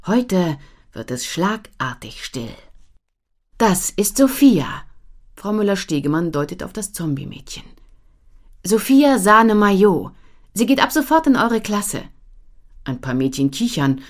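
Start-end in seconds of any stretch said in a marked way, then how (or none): 3.6-4.8 slow, higher teacher introduction. A narrator's reading. Teacher voiced in story. 8.7-12.0 higher, louder teacher voice. A narrator's reading.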